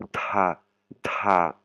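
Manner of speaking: "ta" with a puff of air after the T; aspirated ta. Shown as a demonstration. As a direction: breathe out after the T of "ta". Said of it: "il y a de l'air après mon T".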